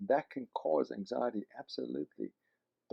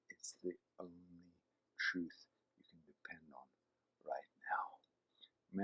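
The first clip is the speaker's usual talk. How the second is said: earnest hush; 7 semitones lower, 12 dB quieter, slower than usual. Real, not acted. Imploring listeners to read scripture.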